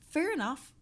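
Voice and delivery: loud and high; loud fair enough. Acted. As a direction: belt it out. Repeats phrase, each take softer.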